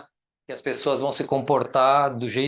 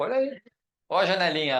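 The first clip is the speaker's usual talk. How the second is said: excited; 6 semitones higher, faster than usual. Real, not acted. Showing residents using railings.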